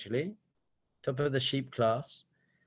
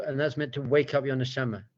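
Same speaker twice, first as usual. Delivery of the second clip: ordinary delivery, nothing marked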